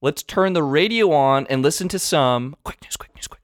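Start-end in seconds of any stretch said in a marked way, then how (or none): 2.6-2.9 whisper. Acted. Whispering radio news jingle. 3.0-3.4 whispered jingle. Acted. Whispered jingle for quick news.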